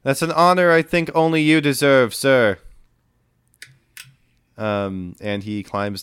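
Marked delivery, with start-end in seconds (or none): 0.0-2.6 loud, high, earnest reply; calling back. Acted. Boy up the mast honoring Arthur, calling down. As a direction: shout it respectfully down from aloft.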